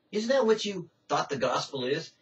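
usual speaking voice

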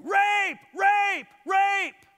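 high, loud mock scream of the accusing wife. Potiphar's wife screams at the household, loud high mock scream. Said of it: "start screaming".

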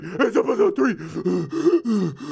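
high, very slow mocking voice; mocking commenters. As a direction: drag out the correction like know-it-all fans. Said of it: "People were like".

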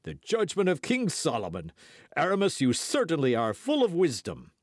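high-pitched, exclaimed approval; crying out. A narrator's reading. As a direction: acclaim the verdict with mock-grand praise. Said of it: "cried the other two guardsmen".

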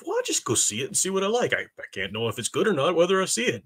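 lightly imitated naive moviegoer, lifting in pitch; mock easygoing moviegoer. Dismissing the days of just going to see it, lightly mimicked moviegoer. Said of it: "Gone are the days of".

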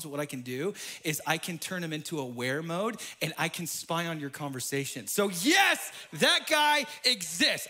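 normal talking voice

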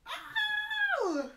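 high loud yelp of delight; yelping squeal; sharp high yelp. Real, not acted. Sudden outcry.

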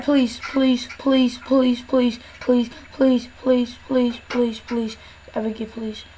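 chant-like pleading that sinks away; repeated begging. Real, not acted. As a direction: beg over and over under your breath, fading. Begging the game to let them in.

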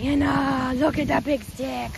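ordinary talk, nothing marked

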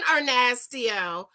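usual speaking voice

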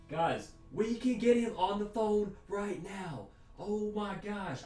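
high, slow excited voice. Pretend. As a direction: gush with excitement. Excited about calling him.

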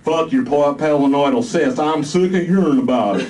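loud, high Southern voice; a fed-up Southern soldier, loud; southern drawl. Acted. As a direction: snap it in a Southern accent. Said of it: "came the thick southern drawl".

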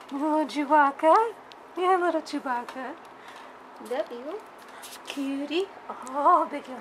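sweet cutesy voice for a puppy; pet talk. Real, not acted. Cooing to a pet.

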